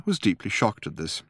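plain reading voice

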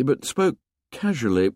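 ordinary delivery

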